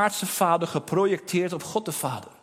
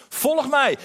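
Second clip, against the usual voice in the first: higher and louder "Volg mij", pressed on "mij"; "Volg mij" lifted and pushed out louder; emphatic call; 8 semitones higher, 5 dB louder than usual. Acted. Jesus calls disciples, repeated.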